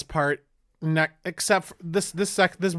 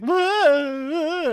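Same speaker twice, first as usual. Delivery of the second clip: stunned whoa; 12 semitones higher than usual. Retold or imitated. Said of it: "the girl who just met him is just like".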